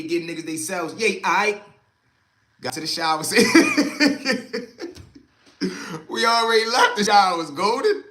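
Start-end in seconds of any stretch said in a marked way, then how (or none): 3.3-5.2 high loud laughter; big laugh. Real, not acted. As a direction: burst out laughing. Laughing at co-host.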